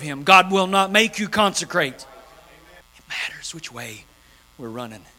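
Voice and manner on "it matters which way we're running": slow, breathy hushed refrain; whispered slow sermon refrain. Acted. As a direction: drop to a whisper for effect.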